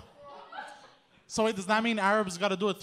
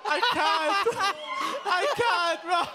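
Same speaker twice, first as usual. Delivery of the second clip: laughing hard; 13 semitones higher, 5 dB louder, much slower than usual. Real, not acted. Losing it laughing.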